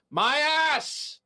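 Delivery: high-pitched, drawn-out comic yell; exaggerated scream from a story being read aloud; screamed in all caps. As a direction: belt it out like all caps with exclamation points. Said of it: "and I screamed".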